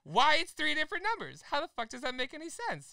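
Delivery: screaming at the phone rep through a laugh; laughing angry yell. Retold or imitated. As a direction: yell it while cracking up at yourself. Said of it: "Sorry for screaming".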